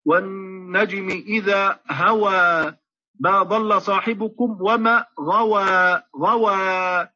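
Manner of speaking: slow chanted هوى and غوى with the mouth wide open; tajweed recitation demo; Quranic recitation with fatḥ. Shown as a demonstration. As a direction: recite slowly, jaws apart on each final a. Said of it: "الفتح يعني أن يفتح القارئ فاه".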